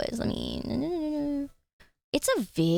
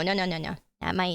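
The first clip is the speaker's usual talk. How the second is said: ordinary delivery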